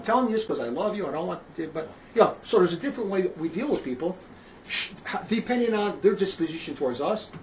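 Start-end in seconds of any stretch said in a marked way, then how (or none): none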